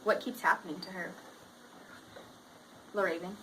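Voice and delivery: lively read-aloud voice for the class; reading to children. A narrator's reading. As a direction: read the picture book to students. Teacher reads story aloud.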